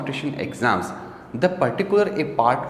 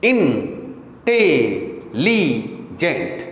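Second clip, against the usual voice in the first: Intelligent very slow, in four separate falling syllables; much slower than usual. Shown as a demonstration. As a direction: break it as इन... टे... ली... gent. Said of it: "चार syllable हो गए". (Intelligent को तोड़कर, धीरे-धीरे)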